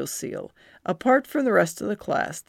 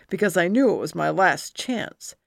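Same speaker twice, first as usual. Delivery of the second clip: ordinary delivery, nothing marked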